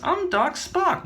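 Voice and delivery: high, drawn-out self-introduction; high slow character voice. Acted. Doctor introducing himself, high and slow. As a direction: announce the name high and slow. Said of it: "He says".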